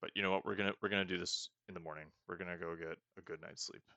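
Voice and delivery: ordinary talk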